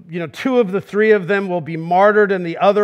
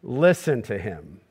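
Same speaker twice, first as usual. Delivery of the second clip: low, slow and solemn; solemn divine pronouncement; voice of God; 11 semitones lower, 7 dB quieter than usual. Acted. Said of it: "the voice of God says to these men".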